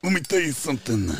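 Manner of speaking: Cleveland voice doing a Macho Man line; Cleveland Brown as Macho Man. Acted. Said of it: "I want you to do Macho Man acting like you're Cleveland Brown".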